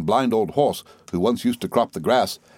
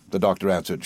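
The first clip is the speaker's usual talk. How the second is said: plain reading voice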